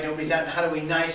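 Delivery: ordinary delivery, nothing marked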